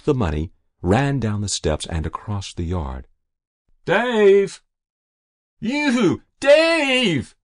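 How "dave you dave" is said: very high distant holler; calling out. A narrator's reading. Mother calling to her runaway son, shouting. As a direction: call after him across a yard.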